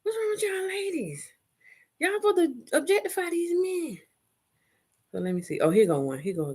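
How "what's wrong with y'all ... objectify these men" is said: mock scolding. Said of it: "I was like".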